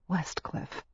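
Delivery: breathed softly into his hair; soft murmur. A narrator's reading. Wife murmurs her husband's name against his hair, barely voiced. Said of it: "she said against his hair".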